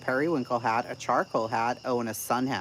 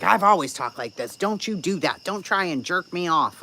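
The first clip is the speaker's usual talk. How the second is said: raised, higher imitation of an angry woman; mock screaming rant; 6 semitones higher, 5 dB louder than usual. Streamer retells the woman's cussing defense, mock angry. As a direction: mimic her heated, defensive telling-off. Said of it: "somebody screaming and cussing at you".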